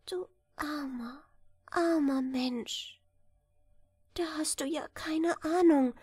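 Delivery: a near-crying lament over the poor man; the princess pities the ignorant prince, softly tearful; sad pity. Acted. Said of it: "Sie sah den Prinzen lange traurig an".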